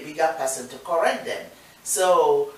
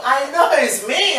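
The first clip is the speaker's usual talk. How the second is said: ordinary talk, nothing marked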